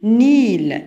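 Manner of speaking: "نيل" held long, one drawn-out syllable; isolated syllable. Shown as a demonstration. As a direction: stretch out "نيل" for pupils to hear. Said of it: "إذا محينا le premier syllabe". ("نيل" مقطع ممدود ببطء)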